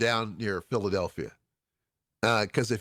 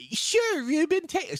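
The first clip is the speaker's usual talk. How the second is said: put-on Philadelphia accent; slower than usual. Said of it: "I'm trying to do a Philadelphia accent".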